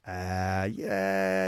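low, drawn-out croaky fry; vocal fry. Shown as a demonstration. Host demonstrates vocal fry to a voice expert, croaky and drawn out. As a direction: let it creak and sag low. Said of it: "that kind of croaky, frying effect".

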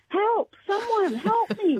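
high, stretched-out cry for help at normal volume; drawn-out mock panic yell. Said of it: "yelling out".

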